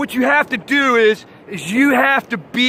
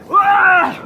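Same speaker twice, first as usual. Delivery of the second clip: high yelp of panic, hammed up; mock scream; 7 semitones higher, 3 dB louder than usual. Surfer acting out a wipeout for the audience, hammed-up high yelp. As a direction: yelp like you're tumbling in a wave. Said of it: "doing this".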